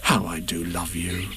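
low, soft and tender; loving Christopher Robin. A narrator's reading. Boy declaring love to bear, tender low voice. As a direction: say it low, with deep affection. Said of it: "said Christopher Robin".

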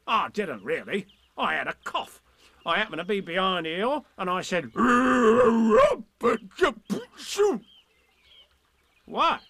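lightly voiced tiger; Tigger voice. Tigger explains.

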